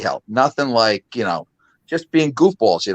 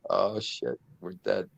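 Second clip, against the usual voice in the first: much softer, under-the-breath panic; hushed dread; 5 semitones lower, 13 dB quieter than usual. Retold or imitated. Boys realizing Dad will catch them, hushed and dreading. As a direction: mutter it like you know you're caught. Kids realize they'll be caught.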